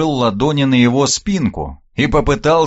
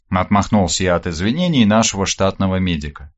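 usual speaking voice

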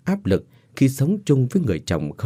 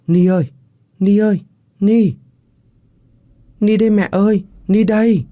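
put-on high, loud eerie child calling for mother; ghost child; 12 semitones higher, 6 dB louder than usual. Ghost child calling to her mother, high and loud. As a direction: call out like a spooky little ghost child.

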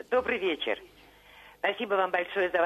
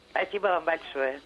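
ordinary talk, nothing marked

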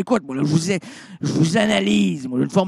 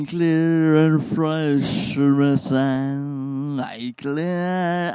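mock jingle; 4 semitones lower, much slower than usual.

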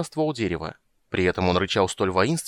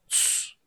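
soft hissing hush; long whispered "tsss"; hissed shush; 8 dB quieter than usual. A narrator's reading. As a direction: shush right into someone's ear. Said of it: "прошипел он ему на ухо".

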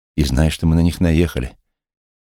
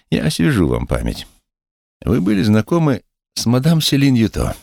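high accusing interrogator; high officer voice; 8 semitones higher than usual. Acted. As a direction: press him, pitched high. Officer questioning.

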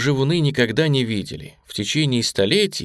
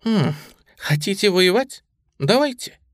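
triumphant smirk; 6 semitones higher than usual. A narrator's reading. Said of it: "торжествующе усмехнулась".

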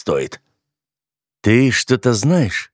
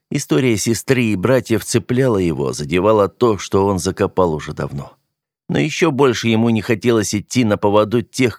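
usual speaking voice

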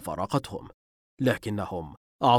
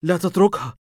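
sharp high alarmed shout; 9 semitones higher, 7 dB louder than usual. Acted. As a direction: yell it in alarm. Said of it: "صرخ به ثائر".

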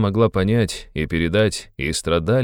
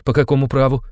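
high, indignant challenge; offended cry; 8 semitones higher, 4 dB louder than usual. A narrator's reading. Sister challenges her brother's order, offended cry. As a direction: exclaim it, stung and higher. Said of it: "вскричала обиженная девушка".

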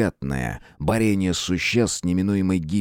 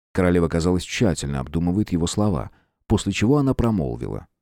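ordinary delivery

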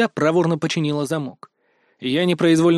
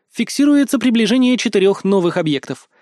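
higher-pitched system report; assistant's alert; 5 semitones higher, 4 dB louder than usual. A narrator's reading. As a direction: announce it like an electronic assistant. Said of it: "сообщила ещё менее радостную весть подруга".

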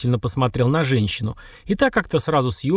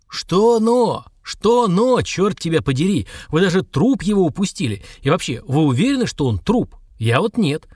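higher, incredulous angry outburst; furious retort; 3 semitones higher than usual. A narrator's reading. Boss rejecting a subordinate's excuse, furious interrogation. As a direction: cut off the excuse and rail at them.

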